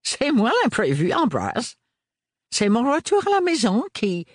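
bubbly eccentric father, full of wonder; eccentric old gentleman. A narrator's reading. Lazarus talking to his son, high and excitable.

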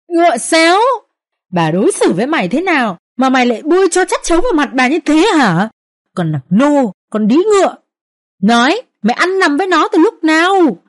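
put-on furious old mistress shrieking insults; shrieking abuse. A narrator's reading. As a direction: scream curses at the servant girl. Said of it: "bà chu chéo lên".

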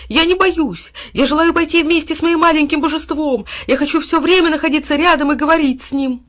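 high, loud, fervent dog voice; protesting. A narrator's reading. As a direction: insist passionately, without letting up. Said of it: "протестующе затряс головой пёс". (пылкий протест пса)

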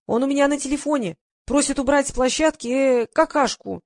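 high call-back at moderate volume. A narrator's reading. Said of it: "крикнула в ответ".

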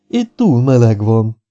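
slow, whiny complaint; whining. A narrator's reading. Mrs Vermington complains about the heat in the car, whining. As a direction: drag it out, sulky. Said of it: "nyafogott missus Vermington".

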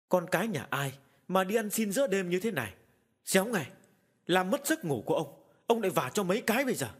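put-on loud, high, furious bark at a beggar child; angry shout. A narrator's reading. Angry old man shouting at the beggar child at night. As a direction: yell to drive the child away, rushing the threat. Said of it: "Ông bực mình quát lớn".

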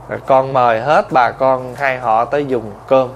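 bow your voice to the guests; humble host's thanks. Retold or imitated. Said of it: "Mình mời ai tới nhà ăn cơm xong".

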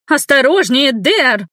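high, loud piercing yell; piercing shout. A narrator's reading. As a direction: cry out in alarm, high and loud. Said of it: "пронзительно закричала".

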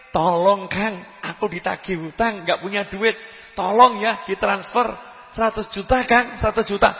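acted urgent request for help; pleading caller. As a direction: beg your friend on the phone. Acted phone plea for money.

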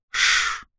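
soft drawn-out shush; slow shushing exhale. Acted. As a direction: breathe out a slow hush. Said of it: "медленно выдохнул".

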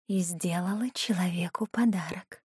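faraway whispered demon voice; a ghostly whisper from far off; distant flashback echo. Acted. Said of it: "далёким эхом звучал в голове голос демона".

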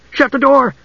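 high, urgent heroine's plea; urgent cry. A narrator's reading. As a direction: cry out in alarm. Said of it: "Usher cried urgently".

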